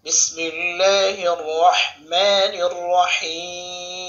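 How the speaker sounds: slow, loud, drawn-out tilawah chant; Quran recitation. Acted. Teacher opens the Quran lesson, slow loud recitation. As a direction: chant each word slowly and fully.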